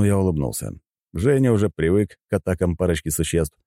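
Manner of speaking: ordinary delivery, nothing marked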